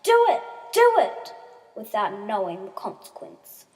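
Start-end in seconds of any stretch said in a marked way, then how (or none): 0.0-1.3 put-on high, loud children's chant; shouting with excitement; excited shouting. A narrator's reading. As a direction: egg them on at full voice. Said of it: "started shouting with excitement".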